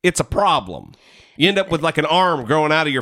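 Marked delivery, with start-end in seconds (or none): none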